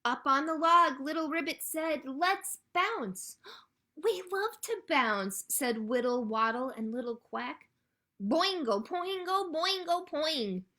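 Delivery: loud, high, bouncy read-aloud; animated storytime reading. A narrator's reading. Librarian reads friends bouncing on the log, animated storytime delivery. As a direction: make the bouncing words spring up for little listeners.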